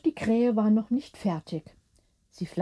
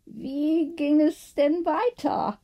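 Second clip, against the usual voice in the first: slow, higher, lightly voiced curious woman; gently curious question; Gertrude asking; 6 semitones higher, slower than usual. A narrator's reading. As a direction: ask it to change the subject. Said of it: "fragte Gertrude schnell".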